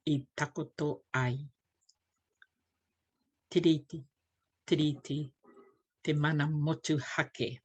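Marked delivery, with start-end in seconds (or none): none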